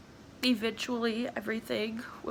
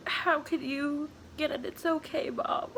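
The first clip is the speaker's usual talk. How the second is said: higher voice, halting through real tears; crying; 5 semitones higher than usual. Real, not acted. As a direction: talk through real crying, pausing to get the words out. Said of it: "I'm sorry for crying".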